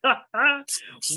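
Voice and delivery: short pleased laugh; a brief delighted laugh at the news. Acted. Prospero delighted by Ariel's report.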